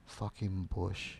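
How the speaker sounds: low, soft and slow; a quiet slow swear under the breath; quiet slow disbelief; muttered curse. Retold or imitated. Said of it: "Eso fue lo primero que yo dije".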